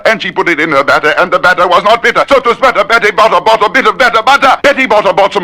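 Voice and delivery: speeding up till the voice squeaks; climbing, rushed. Shown as a demonstration. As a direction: race up to a squeak. Twister sped up, climbing high.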